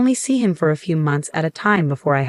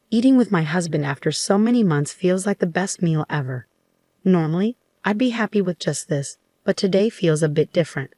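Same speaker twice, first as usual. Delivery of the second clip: usual speaking voice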